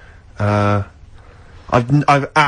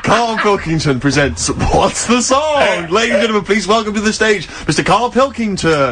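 slow, grand showbiz announcer voice, a little higher; game show announcer; 7 semitones higher than usual. Pretend. Co-host mockingly launches Karl's new quiz show, grand slow announcer voice. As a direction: roll it out like a TV host welcoming the star.

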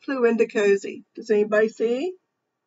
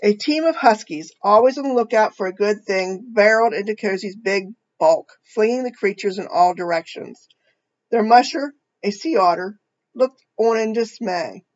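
put-on reading a picture book to children; storybook reading voice; story narration; 7 dB louder than usual. A narrator's reading. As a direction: tell it gently to a young audience.

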